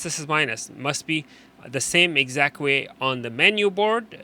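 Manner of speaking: ordinary talk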